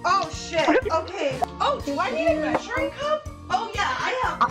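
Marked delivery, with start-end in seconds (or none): none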